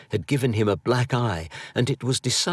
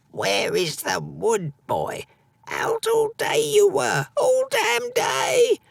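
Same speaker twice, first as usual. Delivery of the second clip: slow, then a much higher shrill-pitched crone scold; ill old mother scolding; 3 dB louder, slower than usual. A narrator's reading. Sick mother scolding her son for forgetting the wood.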